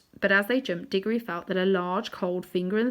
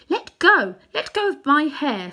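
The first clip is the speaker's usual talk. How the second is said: put-on girl's voice, higher and louder; angry demand; 8 semitones higher, 8 dB louder than usual. A narrator's reading. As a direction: order the witch off her hair. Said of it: "said Polly".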